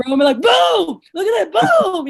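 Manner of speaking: high, punchy show-off hype; imitated high excitement. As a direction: imitate an over-the-top demo with punched booms. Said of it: "I know you might not be like".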